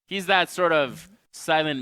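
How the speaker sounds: ordinary talk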